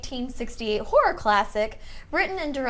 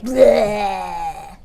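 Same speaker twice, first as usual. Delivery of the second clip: loud zombie growl; 6 semitones lower, 4 dB louder than usual. Shown as a demonstration. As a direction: give a big zombie bleh. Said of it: "like your biggest zombie noise".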